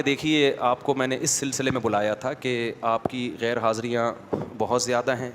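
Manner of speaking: calm, unhurried principal. Retold or imitated. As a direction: gently lay out the problem, no heat. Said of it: "بولتے بھی بڑے ٹھہراؤ کے ساتھ، آرام آرام سے".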